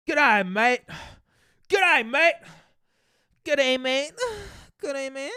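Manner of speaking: put-on high Aussie accent, mostly loud; mock Australian accent. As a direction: call it out like a cartoon Aussie. Tries out Australian greeting repeatedly.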